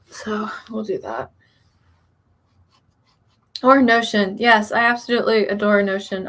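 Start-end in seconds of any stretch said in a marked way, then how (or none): 0.1-1.3 slow whispered aside; whispering. Real, not acted. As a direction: breathe it to yourself. Trailing aside, measured whisper.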